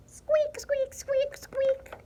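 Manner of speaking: very high little squeaks; four tiny high mouse squeaks. A narrator's reading. Narrator voicing a mouse suitor, high squeaking. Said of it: "and mouse".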